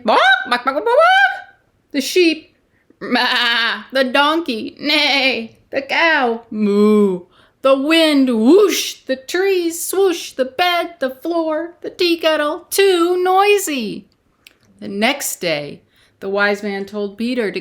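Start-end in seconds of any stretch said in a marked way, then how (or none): 0.0-1.6 high, loud silly barking; yappy bark. Acted. Chicken barks in story. 1.9-14.1 building noisy list. Acted. Noisy night listing sounds.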